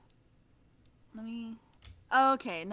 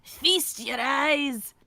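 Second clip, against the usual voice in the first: loud, drawn-out cry, higher pitch; hammed-up movie quote; 4 semitones higher, 6 dB louder, much slower than usual. Friend echoes the host with a movie line, hammed up. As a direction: proclaim it slowly in a put-on voice. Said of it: "feast your eyes on the screen share".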